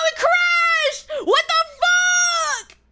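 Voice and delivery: high, loud yell at the crashed game; rage-yelling at a crashed game; furious shout. Real, not acted. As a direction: scream in outrage as the game dies. Game crashes mid-play.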